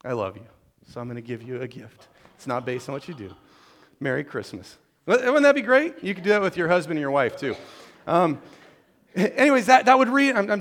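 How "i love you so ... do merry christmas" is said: softened, affectionate voice after a pretend scolding; mock tender parent. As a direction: drop low into gentle reassurance. Mock parent turns tender with gift.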